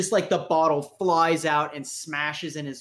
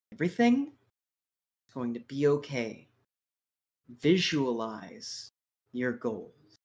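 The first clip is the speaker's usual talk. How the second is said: menacing calm; 2 semitones lower than usual. Acted. Villain calming himself, menacing.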